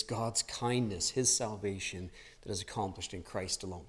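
earnest gospel appeal. Real, not acted. Pastor softly pleading with the congregation, earnest and quiet. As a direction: bring the congregation in close and speak gently.

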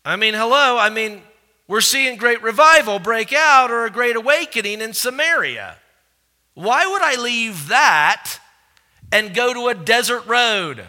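loud, high, incredulous protest to God; Philip's protest. Acted. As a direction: argue back hard, as if the order is absurd.

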